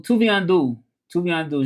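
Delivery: usual speaking voice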